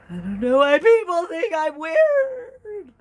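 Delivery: high, loud, drawn-out mock wail; weird wailing voice. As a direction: howl it slowly in a silly voice. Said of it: "It's the weirdest voice on the planet".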